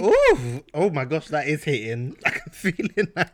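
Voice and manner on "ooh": loud high burn reaction; loud ooh. Real, not acted. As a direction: react to a strong drink. Reacting to the shot.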